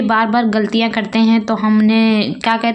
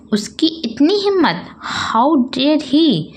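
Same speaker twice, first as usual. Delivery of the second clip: high, outraged how dare he; indignant; 7 semitones higher, slower than usual. Shown as a demonstration. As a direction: sound offended at the gossip. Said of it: "तो हम क्या कहते हैं". (गुस्से और हैरानी से)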